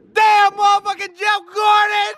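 high shout at a reckless driver; angry shout. Real, not acted. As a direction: yell it out in shock. Yells at speeding car.

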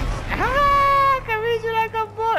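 very high excited yelp; excited squeal. Real, not acted.

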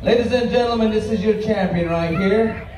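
booming arena announcer call; powwow emcee. Real, not acted. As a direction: announce the champion to the crowd.

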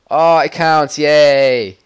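loud, bright yay; triumphant cheer. Real, not acted. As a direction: celebrate the win out loud. Success after tense wait.

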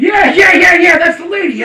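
loud, eager yeahs; excited shouting. Real, not acted. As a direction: shout it out in recognition. Excited agreement.

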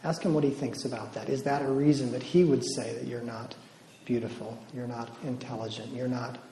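hushed, gentle prayer-leading voice; soft guided prayer. Acted. Retreat speaker to the women, hushed guided prayer. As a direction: lead them quietly into talking with God.

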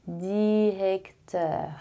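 "Directeur" drawn out very slowly, "di-rec-teur"; very slow repeat-after-me "Directeur"; slow model word. Shown as a demonstration. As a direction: stretch "Directeur" syllable by syllable. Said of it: "Repete aí".

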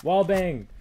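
loud hyped shouting; excited yelling. Real, not acted. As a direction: shout it out in triumph. Hyped gamer callouts.

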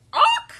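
high, loud "onk" squawk; startled squawk. Acted. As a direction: honk out a bumped hen's cry. Hen gets bumped.

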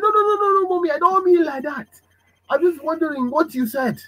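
put-on child pleading with an angry mother. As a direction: talk your way out of trouble.

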